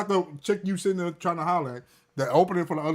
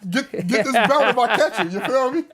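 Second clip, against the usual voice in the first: threatening dad; 6 semitones higher, 4 dB louder than usual. Acted. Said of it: "let's role play your son asking".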